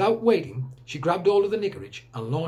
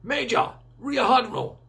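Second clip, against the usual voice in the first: higher call down into the dark; 10 semitones higher, 3 dB louder than usual. Acted. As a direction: call to the men on the ground below. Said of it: "called out into the darkness below".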